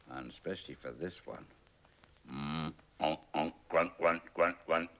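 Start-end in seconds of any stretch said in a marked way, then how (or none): 2.3-4.9 louder grunting and oinking; pig grunts. Acted. Pig wallowing in mud, loud grunts. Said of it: "thought the pig".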